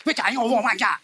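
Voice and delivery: quarrelsome mock Chinese gibberish; mock Chinese accent. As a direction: sound like picking a fight in mock Chinese. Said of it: "ऐसा लगता है अभी झगड़ा करना है".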